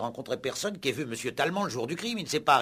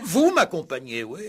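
scornful echo; 4 semitones higher, 7 dB louder than usual. Acted. Commissaire echoes scornfully.

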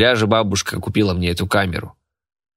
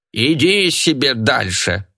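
put-on slow, higher old woman's mumble; mumbling old woman; 5 semitones higher, slower than usual. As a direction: mumble like a toothless granny. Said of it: "прошамкала старушка".

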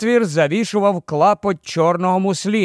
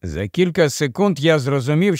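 plain reading voice

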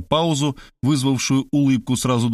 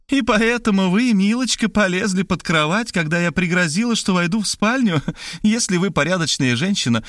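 high, scornful taunting; mocking laughter; 10 semitones higher than usual. A narrator's reading. Maria taunts Markhalyova over hiding under the bed, mocking. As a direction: needle her with mocking glee. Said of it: "а Мария рассмеялась".

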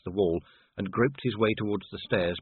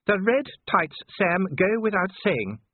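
high-pitched, emphatic overruling; firm wife; 10 semitones higher, 3 dB louder, slower than usual. A narrator's reading. Sybil insists on the red tights, firm high voice. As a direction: shut down a husband's plea, firmly.

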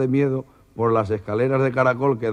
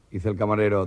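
ordinary talk, nothing marked

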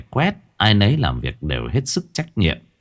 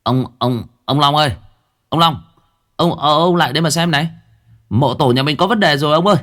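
panicked calling; 7 semitones higher, 3 dB louder, faster than usual. A narrator's reading. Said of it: "Giọng của nó lúc này hoảng hốt".

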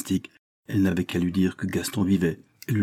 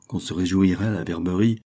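usual speaking voice

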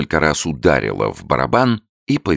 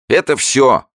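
high, loud and slow barker question; quizzing showman; 12 semitones higher, 6 dB louder than usual. A narrator's reading. Showman quizzing his newt, high loud barker. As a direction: test the beast for the crowd. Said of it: "сказал он".

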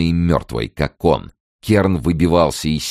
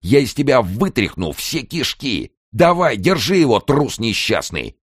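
high, forceful bullying tirade; bully him into obeying; furious yelling; 9 semitones higher than usual. A narrator's reading. Kern threatening his cowardly cellmate, furious yelling. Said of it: "заорал он".

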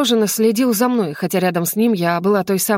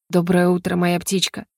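plain reading voice

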